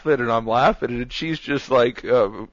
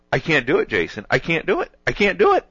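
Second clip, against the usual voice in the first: higher, insistent mock protest; retold exasperation; 8 semitones higher than usual. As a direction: imitate someone insisting, pitch climbing with each repeat. Retold friend's distressed confession.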